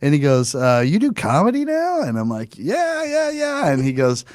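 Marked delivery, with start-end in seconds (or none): 0.6-2.0 mock neighbor. Neighbor voiced. 2.6-3.7 sheepish high yeahs. Retold or imitated. Comic answering his neighbor, high and embarrassed.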